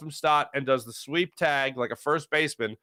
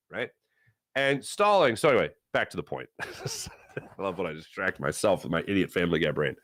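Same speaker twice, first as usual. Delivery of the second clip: ordinary talk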